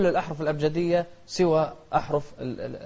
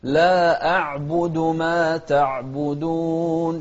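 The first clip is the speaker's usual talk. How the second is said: slow melodic tajweed chant; Quran recitation; 5 dB louder, much slower than usual. Shown as a demonstration. Teacher reciting Quran to students, slow melodic chant.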